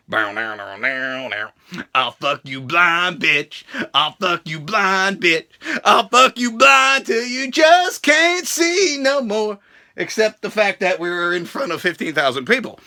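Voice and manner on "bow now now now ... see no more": much lower, slow mock tough guy; Roman Reigns impression. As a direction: growl it low and slow like a macho brawler. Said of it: "And Roman Reigns is like".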